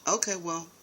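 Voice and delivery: normal talking voice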